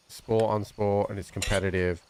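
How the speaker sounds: ordinary delivery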